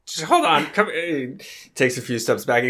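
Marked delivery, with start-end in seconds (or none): 0.1-1.4 high, halting frightened character voice; comic spider terror, haltingly; panicked eccentric. Acted. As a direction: gasp it out in high-pitched bursts.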